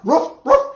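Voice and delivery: loud high ruff ruff; dog barking. Acted. Storyteller barks for the dog to the kids. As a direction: bark it out like a puppy. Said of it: "the dog barked".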